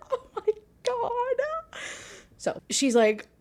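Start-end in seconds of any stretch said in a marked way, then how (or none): 0.1-1.8 very high, drawn-out squeal; squealed swooning reaction. Real, not acted. Reader swooning over the characters flirting, squealed. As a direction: stretch it out high and thin, overcome.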